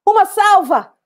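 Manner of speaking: high, loud military order; shouted command. Acted. Commander to the troops at the graveside, shouted order. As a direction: shout it out over the mourners. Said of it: "Então o comandante gritou".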